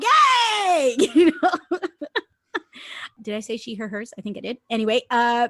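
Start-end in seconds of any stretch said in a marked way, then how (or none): 0.0-1.0 loud, high Hey back; answering shout. Retold or imitated. Reply shout to the call. 1.0-1.9 laughing. Real, not acted.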